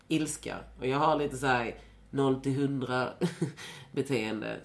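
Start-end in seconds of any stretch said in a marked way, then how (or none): none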